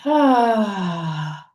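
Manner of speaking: big exhaled "ah", sliding down; loud, drawn-out sigh of release; audible sigh. Shown as a demonstration. Guide breathing out with the listeners a second time, audible sigh. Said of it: "let it out with a sigh".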